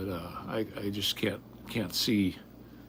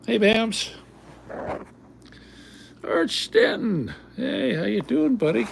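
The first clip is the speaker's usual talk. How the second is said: high, loud drawn-out hello; singing out names in a raised voice; hearty greeting; 14 semitones higher, 5 dB louder than usual. Real, not acted. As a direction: call out to a buddy who just arrived. Greets viewers joining the stream.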